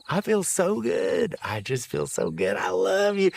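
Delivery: lightly voiced old man, swooping high with joy; a hint of a giddy old drunk; tipsy happy papa. Old husband to wife after jasmine ale, giddy. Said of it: "It's been years".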